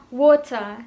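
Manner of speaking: "water" with a true T and no final R; British "water", like "wo-tah"; British accent. Shown as a demonstration. Teacher showing how English people say it, British accent. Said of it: "Orang-orang Inggris akan mengatakan".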